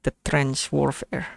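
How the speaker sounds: normal talking voice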